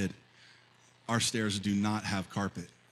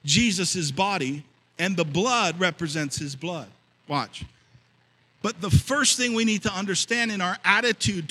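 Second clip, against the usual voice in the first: higher, louder pulpit preaching; 6 semitones higher, 5 dB louder than usual. Acted. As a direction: preach it to the congregation. Preaching the scripture passage.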